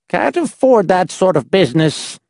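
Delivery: name the price, miserly; grasping old man. A narrator's reading. The little man charges the visitors, high and miserly.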